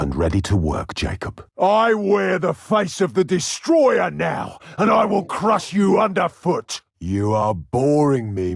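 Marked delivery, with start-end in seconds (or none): 1.6-6.8 villain crushing threats; roaring threat. Acted. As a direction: bellow it down at your foe.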